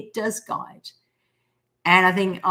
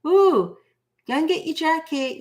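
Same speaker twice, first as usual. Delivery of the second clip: higher, eager voice of the universe; the universe's voice, pitched higher and louder; universe nudging, higher; 9 semitones higher, 3 dB louder than usual. Retold or imitated. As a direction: call out the reminder higher and brighter. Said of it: "the universe will always say".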